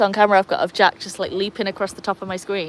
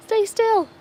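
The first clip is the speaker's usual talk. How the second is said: high-pitched coaxing plea after a gasp; gasp, then beg him high to hold still; squeaky gasp; 12 semitones higher than usual. Real, not acted. Owner coaxing her molting dog, high-pitched and pleading. Plucking the dog's molting fur.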